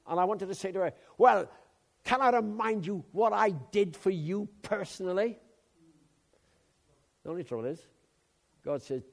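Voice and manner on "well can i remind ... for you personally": indignant retort. Pretend. Retort he wanted to give the woman.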